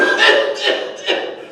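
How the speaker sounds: high, spluttering cough; acted coughing fit. Rescued boy on the pool side coughs up water.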